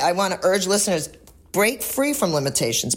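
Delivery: usual speaking voice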